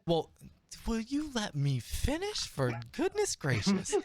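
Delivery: high, huffy, hammed-up protest; mock exasperation. GM scolds an interrupting player in mock huff. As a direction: play the put-upon GM.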